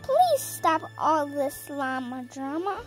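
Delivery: ordinary delivery, nothing marked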